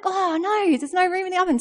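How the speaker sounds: high, dismayed little cry of oh no; mock dismay. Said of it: "I was like".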